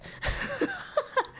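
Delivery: real burst of high laughter; giggling at the formal email; high squeaky laugh. Real, not acted.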